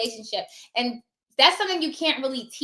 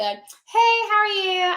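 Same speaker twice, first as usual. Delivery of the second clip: high, chirpy hello to a receptionist; bright friendly greeting; 9 semitones higher, 4 dB louder, much slower than usual. Shown as a demonstration. Notary greeting the front desk, bright and high. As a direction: greet them like an old friend, pitched up. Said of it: "it's basically like a".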